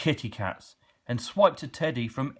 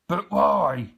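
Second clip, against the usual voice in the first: surprised; 7 semitones higher, 6 dB louder than usual. A narrator's reading. Grunt surprised at leaving.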